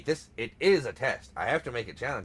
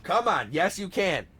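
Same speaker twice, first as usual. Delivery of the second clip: high, loud mock pep talk; loud urging; 5 semitones higher, 7 dB louder, slower than usual. Insisting partner can do the impression, loud playful urging. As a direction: egg them on forcefully.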